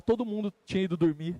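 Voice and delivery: ordinary talk, nothing marked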